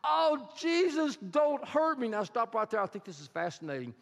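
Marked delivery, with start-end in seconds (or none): 0.0-2.1 high, slow, pleading cry of terror; terrified plea. Acted. Begging for mercy in a frightened high voice. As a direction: demoniac begs Jesus not to hurt him, terrified high plea. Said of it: "he says".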